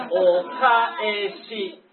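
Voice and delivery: stretched out お返し, very slow and clear; slow model word. Shown as a demonstration. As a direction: say お返し slowly for the class to copy. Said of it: "Are you ready".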